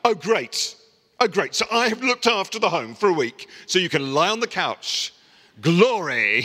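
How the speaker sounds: wife sarcastic on coming home. Retold or imitated. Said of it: "my wife".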